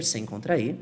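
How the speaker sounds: ordinary talk, nothing marked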